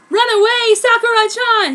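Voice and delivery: high, loud urgent warning; urgent call. A narrator's reading. Kishu tells Sakura to flee, loud and high. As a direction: urge her to flee at full voice. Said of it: "he said".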